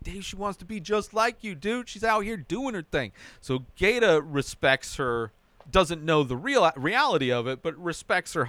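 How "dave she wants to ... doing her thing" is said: higher-pitched, lightly acted admiring voice; impressed mock quote. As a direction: imitate someone impressed, pitched up high. Acted admiring remark to Dave.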